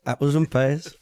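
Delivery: hint of a mock Cockney accent. Streamer repeats the slang to his viewers in mock Cockney. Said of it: "It's Cockney slang".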